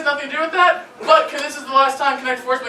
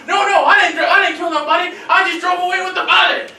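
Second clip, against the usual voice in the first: panicked suspect; 6 semitones higher, 4 dB louder than usual. Retold or imitated. Suspect protests in noir film.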